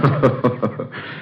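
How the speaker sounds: a quiet breathy chuckle, brushing it off; soft dismissive laugh. Acted. Doctor dismisses his patient's ghostly notes, soft scoffing chuckle.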